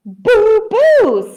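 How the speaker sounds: high, loud boos; shouting. A narrator's reading. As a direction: shout boo after someone fleeing. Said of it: "Ben shouted after him".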